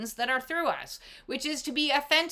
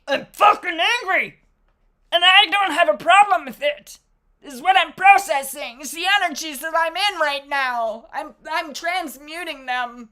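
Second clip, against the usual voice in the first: loud, higher, openly angry declaration; owning anger; 5 semitones higher, 7 dB louder than usual. Acted. Answering a friend's check-in, loudly and angrily. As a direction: let the anger out loud and unapologetic.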